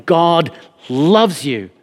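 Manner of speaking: slow, earnest blessing; sincere assurance. Retold or imitated. Preacher telling every person God loves them, slow and sincere. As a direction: speak it slowly as a heartfelt promise. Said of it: "look to every single human being and say".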